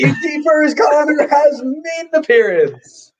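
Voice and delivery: high, slow put-on announcer voice; lightly hammed-up commentator call; mock commentator. Fan mock-announcing a player's arrival, high slow put-on voice.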